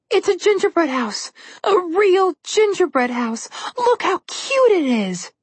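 high, slow and gushing with delight; thrilled girl marveling at a treat; excited. Acted. Girl thrilled at candy house.

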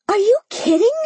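very high, incredulous cry. A narrator's reading. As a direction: squeal it in disbelief. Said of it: "Mimi cried".

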